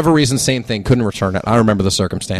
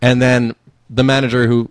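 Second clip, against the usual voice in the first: ordinary talk, nothing marked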